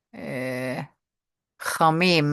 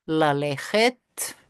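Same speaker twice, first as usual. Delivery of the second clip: "lalechet" drawn out slowly, rising at the end; slow demo; much slower than usual. Shown as a demonstration.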